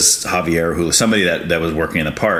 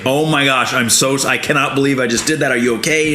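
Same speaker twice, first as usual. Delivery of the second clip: groveling apology; 7 semitones higher than usual. Retold or imitated. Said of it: "just groveling".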